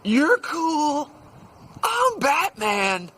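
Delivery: high, loud, stretched-out proclamation; triumphant shout. Retold or imitated. Quitting telemarketer on his chair to a friend, triumphant drawn-out shout. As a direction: holler it slowly like a grand exit line. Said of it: "And he goes".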